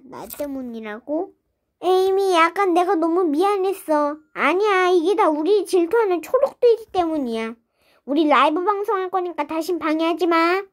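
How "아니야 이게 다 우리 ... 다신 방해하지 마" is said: ordinary talk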